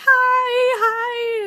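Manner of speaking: high, loud nervous hello; excited greeting. Retold or imitated. Greeting the dancers backstage, high excited hello.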